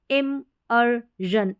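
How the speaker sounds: इमर्जन very slowly in pieces, im-er-zhun; syllable-by-syllable इमर्जन. Shown as a demonstration. Teacher sounding out a word for learners, इमर्जन in slow syllables. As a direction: break इमर्जन up, ending in voiced zh. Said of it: "इस तरीके से ई आर का sound".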